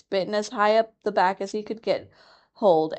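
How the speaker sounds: usual speaking voice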